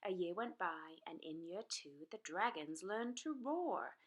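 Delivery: plain reading voice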